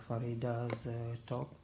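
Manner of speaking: normal talking voice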